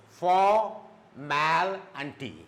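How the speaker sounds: formality broken into for, mal, T with pauses; syllable by syllable. Shown as a demonstration. Teacher showing three syllables of formality, syllable by syllable. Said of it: "there are three syllables".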